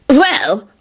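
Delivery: high, affronted huff. A narrator's reading. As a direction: huff it, slighted. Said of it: "Percy huffed".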